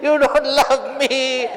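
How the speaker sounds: put-on high, wailing childlike complaint; mock crying. Hanuman crying to Ram after being questioned, mock wailing. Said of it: "Hanumanji started crying".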